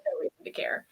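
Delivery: unvoiced whispered aside. Real, not acted. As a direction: drop to a breathy whisper. Aside on Buffy.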